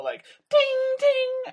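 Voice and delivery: high ringing ding, ding, like metal striking metal; imitated metal clang. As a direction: sing out the dings like a watch banging on a wall. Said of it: "think of, like, metal on metal".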